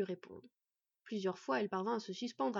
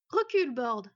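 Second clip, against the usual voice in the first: loud urgent warning; crying out; 3 semitones higher, 9 dB louder than usual. A narrator's reading. She-wolf warns the boy off, crying out. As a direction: call him back sharply and loudly. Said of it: "s'écria Fen".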